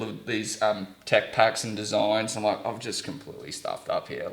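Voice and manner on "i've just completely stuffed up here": low, quiet self-reproach; retold dismay. Traveller to himself, stranded with a suitcase, low dismay. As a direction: say it lower and softer, to yourself. Retells despairing moment alone abroad.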